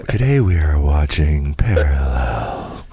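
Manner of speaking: slow, drawn-out mock-soothing host; sultry dulcet tones. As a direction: lull them to sleep with hammed-up podcast intro. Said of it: "The sultry dulcet tones".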